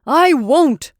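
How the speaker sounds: loud, high raging refusal. A narrator's reading. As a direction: refuse in a fury. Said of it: "raged the freckled man".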